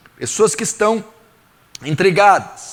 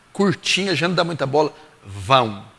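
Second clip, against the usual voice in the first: ordinary talk, nothing marked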